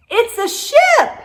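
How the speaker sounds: high loud delighted cry; Wavy spots the toy ship; excited shout. Acted. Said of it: "Wavy shouted".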